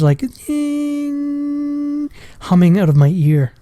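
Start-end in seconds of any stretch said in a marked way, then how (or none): 0.5-2.1 high, thin ringing tone hummed softly; imitated ear ringing. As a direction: mimic the whine in his ear, much higher and softer. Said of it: "it was like a high tinge".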